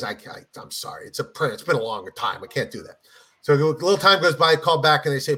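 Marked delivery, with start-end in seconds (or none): 0.0-3.0 low, soft apologetic refusal; reluctant pope. Acted. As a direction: decline quietly, voice dropped well down. Pope declines offer in joke.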